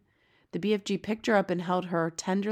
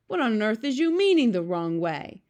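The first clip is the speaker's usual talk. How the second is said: loud, higher, baffled question; indignant cry; 4 semitones higher, 6 dB louder than usual. A narrator's reading. B F G questions Sophie's remark, crying out. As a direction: demand it in disbelief. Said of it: "cried the B F G".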